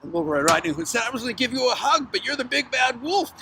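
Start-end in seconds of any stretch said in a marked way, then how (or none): 1.0-3.3 put-on high, rapid childlike protest. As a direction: blurt it out high and fast. Girl realizes it is the wolf.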